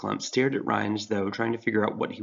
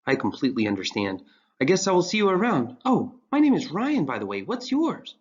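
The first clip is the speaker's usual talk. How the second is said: put-on higher, eager young voice; earnest teen boy; 7 semitones higher, 4 dB louder than usual. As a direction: play Ryan bright and earnest.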